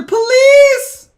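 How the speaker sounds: high, loud cry for the police; shouted call. Acted. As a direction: scream for the police. Calling for police.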